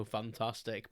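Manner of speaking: a low, deflated Fantastic, meant as happy; exhausted, unconvincing Fantastic; flat fantastic. Retold or imitated. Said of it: "I meant it to sound a lot more happy than it did".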